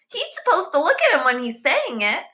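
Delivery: put-on high, bright-eyed kid voice; piping boy. A narrator's reading. Boy correcting the teacher, high know-it-all voice. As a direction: pipe up like a know-it-all pupil.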